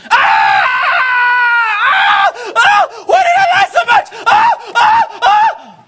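shrieking high and loud in torment; anguished screams from hell. Acted. Preacher voicing a damned soul, agonized screaming. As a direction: scream like a soul cast into fire.

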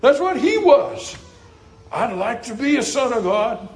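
high, exalted pulpit delivery; stretched, ringing preacher's cadence; fervent preaching. Real, not acted. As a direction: lift it up high as a sermon climax.

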